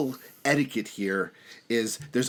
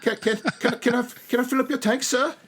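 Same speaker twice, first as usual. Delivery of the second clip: very high, stammering Cockney orphan; 12 semitones higher, 3 dB louder than usual. Acted. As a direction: stammer politely like a tiny urchin. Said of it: "a Cockney accent as done by a young orphan".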